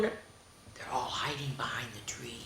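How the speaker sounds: hushed whisper. Acted. Teacher whispering to kids about friends hiding, whispering. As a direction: whisper it like a secret.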